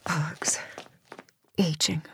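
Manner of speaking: a scornful breath of a word; bitter whisper. Acted. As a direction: whisper it to yourself with contempt. Janice alone, brooding.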